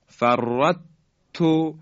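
light merged ت in فرط تو; wrong complete merging. Shown as a demonstration. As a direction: lose the ط of فرط entirely in a doubled ت. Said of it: "در حقیقت کامل دیگه".

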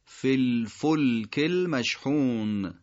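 فی الفلک المشحون slowly joined, with a short "fil"; three words linked. Shown as a demonstration. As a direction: link فی الفلک المشحون syllable by syllable, drawn out. (فی الفلک المشحون شمرده و متصل، با «فِ» کوتاه)

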